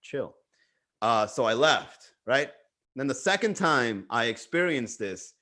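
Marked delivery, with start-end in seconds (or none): 0.0-0.3 soft, low-key placating; hushed, careful reassurance; calming her down. Retold or imitated. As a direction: ease her down quietly. Calming down an angry woman.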